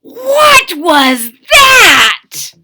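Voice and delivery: very high, loud, slow startled cry; startled shout. A narrator's reading. Kitten startled by his mirror, shouting. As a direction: shout out in shock.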